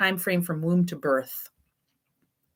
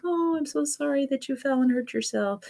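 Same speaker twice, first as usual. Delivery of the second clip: much higher soothing parent voice; sympathetic parent; 9 semitones higher than usual. Retold or imitated. Parent soothing a child who hurt himself, high sympathetic voice. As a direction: soothe the crying kid like a doting parent. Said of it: "they're like".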